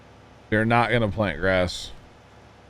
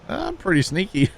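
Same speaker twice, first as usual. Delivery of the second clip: much higher, lightly voiced sly customer; a hint of a pleased sly guy, pitched up; customer's sly reply; 10 semitones higher than usual.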